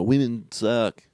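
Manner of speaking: slow, put-on chauvinist voice; deadpan it like a jerk; mock sexist voice. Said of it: "and then just go".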